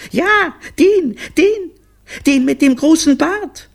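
put-on very high, loud kid voice; excited children. Children answering their mother, high and excited. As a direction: pipe up at the top of the voice.

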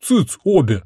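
grumpy father's rebuke; grumbling. A narrator's reading. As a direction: hush them with a grumble. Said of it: "пробурчала одна из голов".

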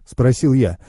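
usual speaking voice

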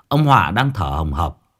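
ordinary delivery, nothing marked

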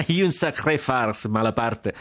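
high cackling laughter round a drawn-out cry; laughing shout. A narrator's reading. Jack celebrating the farce with Malaparte, laughing shout. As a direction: burst out laughing and crow in delight. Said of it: "gridava Jack".

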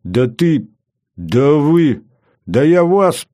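rising sputter of indignation; starting to boil over. A narrator's reading. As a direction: climb in pitch with each broken threat. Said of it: "начал закипать Кравцов".

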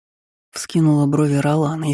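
ordinary delivery

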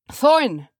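high, loud call; shout. A narrator's reading. Dwarf shouting for his leader from the bushes, urgent. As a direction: cry out the name urgently. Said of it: "came a shout".

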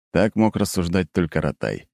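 ordinary delivery

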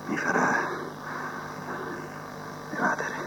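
"Mi farà evadere" whispered almost without voice. Acted. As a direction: breathe the words as a secret. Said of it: "repeated after quiet take".

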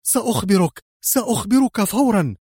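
high, panicked surrender; utterly terrified. A narrator's reading. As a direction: cry out, desperate to give in. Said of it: "بكل رعب الدنيا، وهو يهتف". (باستسلام مذعور)

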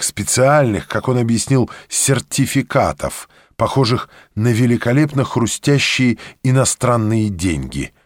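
usual speaking voice

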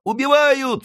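put-on very high, loud boy's scream; falsetto alarm. A narrator's reading. Friquet echoing the old servant, very high loud scream. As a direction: shriek the alarm. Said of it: "вторил ей Фрике".

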